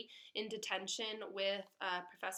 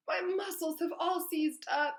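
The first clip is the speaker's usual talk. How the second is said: groaning; 8 semitones higher, 6 dB louder than usual. Acted. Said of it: "he groaned".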